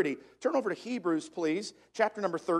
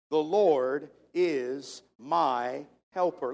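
very slow, lower, evenly spaced words; leading group recitation; 4 semitones lower, much slower than usual. Shown as a demonstration. Preacher leading the congregation in saying it together, slowly. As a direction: lead a crowd reciting word by word. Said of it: "Can we say that together today?".

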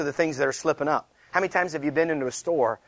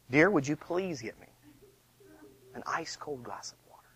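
much softer, polite husband's plea; courteous soft request; 11 dB quieter than usual. Shown as a demonstration. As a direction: ask sweetly, fading almost to a whisper.

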